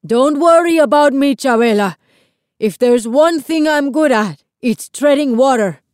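projected, hearty yell; yelling back. A narrator's reading. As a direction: call back across the waves. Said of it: "Lito yelled back".

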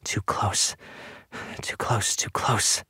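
hushed, breathy mutter with little voice; muttering to herself. A narrator's reading. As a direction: mutter it anxiously under your breath. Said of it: "muttering to herself as she paced".

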